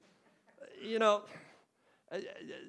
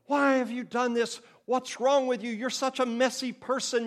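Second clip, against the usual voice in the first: higher, louder exasperated parent; shaming scold; 7 semitones higher, 3 dB louder than usual. Retold or imitated. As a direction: scold the child for the spilled milk. Parent scolding over spilled milk.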